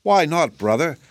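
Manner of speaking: mildly anxious question, starting high; understated worry. A narrator's reading. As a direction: ask with a touch of concern. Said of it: "asked Shaggy anxiously".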